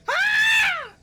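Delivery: loud, very high-pitched scream. Acted. Game master voices the victim of the spell, piercing scream. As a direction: scream out in sudden agony. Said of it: "and he just screams".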